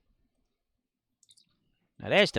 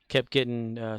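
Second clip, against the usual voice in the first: ordinary delivery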